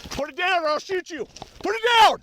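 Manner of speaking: building to a full shout; from firm warning up to a yell. Real, not acted. Suspect approaching with knife.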